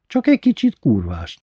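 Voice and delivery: plain reading voice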